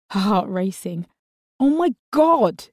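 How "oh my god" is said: high, slow startled cry; frightened yelp. Acted. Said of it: "Kat yelped".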